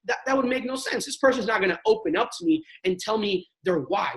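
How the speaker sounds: ordinary talk, nothing marked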